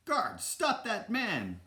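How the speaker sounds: high, drawn-out shouted order; acted urgent call to the guards; crying out. A narrator's reading. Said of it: "Basil cried as he and Dawson chased".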